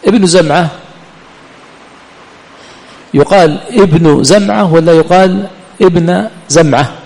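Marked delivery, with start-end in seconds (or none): 3.1-6.9 ابن said first as 'ibnu', then as 'ibna'; ابنُ ثم ابنَ; damma versus fatha. Shown as a demonstration. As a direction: contrast 'ibnu zamʿa' with 'ibna zamʿa', slowly. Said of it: "بالفتح ولا بالضم".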